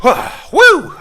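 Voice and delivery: high loud victory whoop; triumphant whoop. Real, not acted. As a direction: let out a big cheer. Finishing push-ups.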